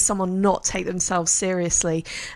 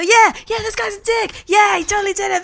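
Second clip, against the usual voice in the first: eager lawyer voice; 9 semitones higher, 4 dB louder than usual. Retold or imitated. Quoting the lawyer agreeing eagerly.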